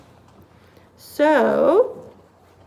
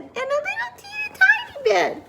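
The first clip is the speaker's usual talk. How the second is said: very high, squeaky little voice; high-pitched, for something minuscule; teeny tiny voice. A narrator's reading. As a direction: shrink the voice to a squeak. Voices the tiny bed's size.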